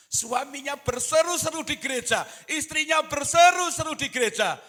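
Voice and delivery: high, loud pulpit shout; full-force preaching. Real, not acted. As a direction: preach it loudly on a high pitch, easing at the end. (khotbah berapi-api dengan suara lantang)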